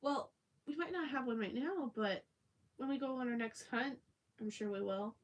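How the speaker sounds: high, loud cheerful seller; vendor voice. Retold or imitated. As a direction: play the upbeat stall guy. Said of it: "the guy goes".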